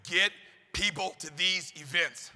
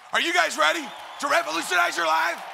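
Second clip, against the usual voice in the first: rallying shout; 8 semitones higher than usual. Real, not acted. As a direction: roar it at the whole arena. Preacher rallies the audience.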